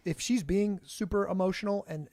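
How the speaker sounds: ordinary delivery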